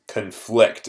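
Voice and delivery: "conflict" as a verb, drawn-out con-FLICT; stress on second syllable. Shown as a demonstration. Teacher reads the verb definition to students, stress on the FLICT of "conflict". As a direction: lean slowly on the FLICT in "conflict". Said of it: "and again, different stress".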